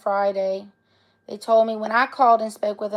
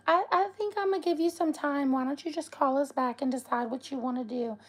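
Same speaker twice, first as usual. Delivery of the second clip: higher, softer hesitant voice for the caller; imitating the vet lady; 4 semitones higher, 3 dB quieter than usual. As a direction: gently put on a high-pitched, tentative phone voice. Voicing vet's awkward staffer on phone.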